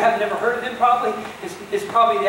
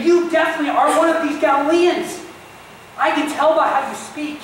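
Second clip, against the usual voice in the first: higher, louder pointed accusation; 6 semitones higher, 4 dB louder than usual. Retold or imitated. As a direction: call him out in front of everyone. Bystanders accusing Peter.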